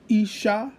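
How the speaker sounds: usual speaking voice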